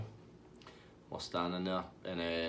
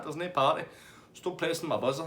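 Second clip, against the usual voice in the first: higher-pitched retold refusal; mock annoyed woman; 7 semitones higher than usual. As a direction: imitate her shutting him down. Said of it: "And she's like".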